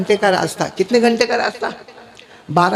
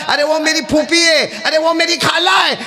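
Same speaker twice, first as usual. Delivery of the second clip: calling out relatives with rising joy; a pointing man shouting out, delighted; excited recognition; 7 semitones higher than usual. Acted.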